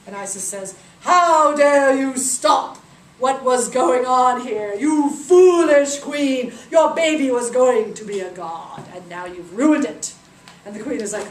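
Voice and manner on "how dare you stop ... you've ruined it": loud, slow, commanding scold; furious goddess. Acted. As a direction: thunder down at the foolish queen. Isis scolds the queen.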